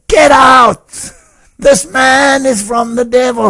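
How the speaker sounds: high, loud, slow furious shout; shouting at the hall. Retold or imitated. Man shouting to the entire hall that the preacher is from the devil, furious shout. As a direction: denounce him to the whole hall at full voice. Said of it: "he shouted to that entire hall".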